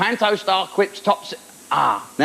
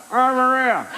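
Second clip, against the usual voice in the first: heckler; 6 semitones higher, slower than usual. Retold or imitated.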